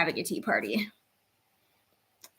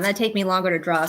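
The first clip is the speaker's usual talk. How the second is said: usual speaking voice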